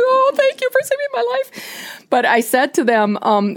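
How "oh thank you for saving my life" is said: much higher, choked-up retold thanks; teary thanks. Kayaker retelling thanking her rescuers, tearful and high. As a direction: replay the tearful gratitude in a high, wobbly voice. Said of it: "I was in tears".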